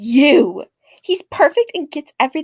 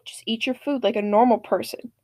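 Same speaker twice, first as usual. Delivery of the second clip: usual speaking voice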